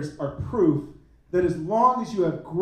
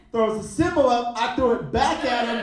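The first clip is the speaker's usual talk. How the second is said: ordinary talk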